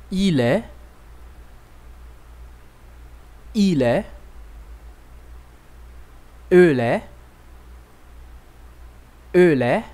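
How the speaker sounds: each pronoun and verb said twice, clearly, with pauses for the class; repeat-after-me French drill. Shown as a demonstration. Teacher leading students through être, clear model drill. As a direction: model "Je suis", "Tu es", "Il est" as a teacher. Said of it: "Repita comigo".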